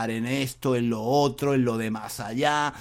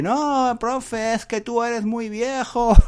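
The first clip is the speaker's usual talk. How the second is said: higher, cheeky comeback; student laughing back; 8 semitones higher, slower than usual. Retold or imitated. James laughing back at his teacher. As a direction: tease the teacher right back. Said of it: "el James se ríe".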